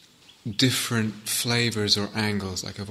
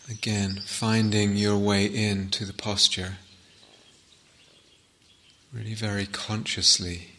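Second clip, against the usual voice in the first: unhurried, softer phrases left to settle in silence; guided meditation; 6 dB quieter than usual. Acted.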